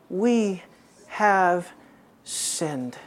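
slow and low, sinking to near silence; word by word, dropping away softly; hushed confession. Acted. Slow solemn reading of confession.

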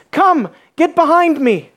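slow, high, firm come get behind me; preacher's blunt call; blunt command. Shown as a demonstration. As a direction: say it simply, no softening. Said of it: "I'm not gonna mince words".

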